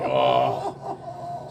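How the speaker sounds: low groaning oh; mock groan of stomach pain. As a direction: groan like your gut just turned. Reacting to the stomach cramps.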